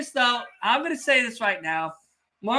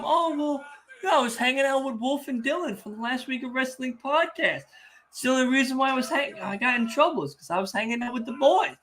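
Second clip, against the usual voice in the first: mock excuse; 6 semitones higher, faster than usual. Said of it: "I don't want you telling them".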